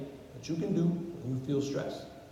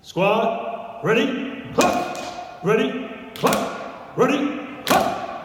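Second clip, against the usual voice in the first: loud, higher barked drill calls; drill cadence; 5 semitones higher, 7 dB louder, much slower than usual. Shown as a demonstration. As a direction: bark it in a steady Marine cadence. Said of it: "Listen to my cadence".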